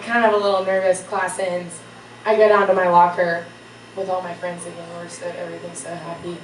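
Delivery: ordinary talk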